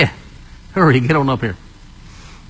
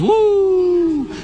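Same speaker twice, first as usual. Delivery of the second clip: higher wailing woo; howling wind woo; 10 semitones higher than usual. Shown as a demonstration. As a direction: howl it high like a storm wind. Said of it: "Don't do your hands".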